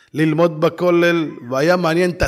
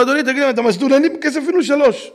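higher, louder, fast protest; exasperated protest; 11 semitones higher, 5 dB louder, faster than usual. Retold or imitated. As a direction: push back in disbelief. Speaker protests to salesman. (מחאה נרגשת בקול גבוה)

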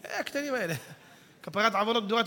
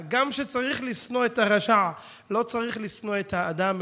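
normal talking voice

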